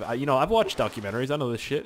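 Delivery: ordinary talk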